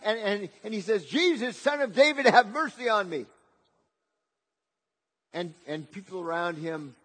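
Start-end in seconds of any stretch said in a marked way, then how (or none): 1.0-3.2 loud, high plea, imitating a beggar; acted excited cry for mercy. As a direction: call out over a crowd for help. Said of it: "begins to cry out".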